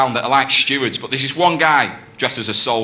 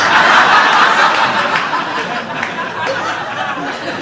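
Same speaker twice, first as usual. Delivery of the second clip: high breathy laugh. Real, not acted. Laughs at own joke.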